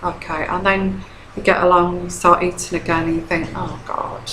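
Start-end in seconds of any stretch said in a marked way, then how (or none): 3.5-4.3 thought aloud. Retold or imitated. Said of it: "that's what you're thinking".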